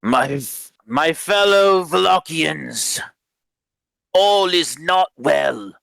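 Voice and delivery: high, drawn-out proclamation; loud announcement. Acted. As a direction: address the whole room like a town crier. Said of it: "not yells, but announces loudly".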